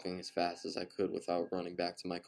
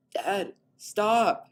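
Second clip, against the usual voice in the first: much higher, louder pleading shout; pleading cry; 14 semitones higher, 12 dB louder than usual. A narrator's reading. Daughter begging her father to stop, high pleading cry. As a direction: beg him to stop. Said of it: "Katie pleaded".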